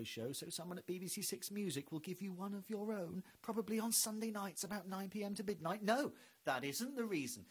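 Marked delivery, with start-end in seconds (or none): none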